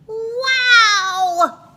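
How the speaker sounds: put-on loud, very high kid's whoop; excited yell. A narrator's reading. Kids cheering their teacher's absence, loud excited yell. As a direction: whoop with glee like a child. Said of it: "yelled the kids".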